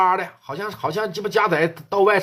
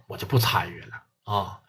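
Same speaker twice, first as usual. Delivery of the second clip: hushed backing out; 9 semitones lower, 7 dB quieter than usual. Acted. Yang Biao quietly refuses the police captain, in a whisper. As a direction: drop to a near whisper, wanting no part.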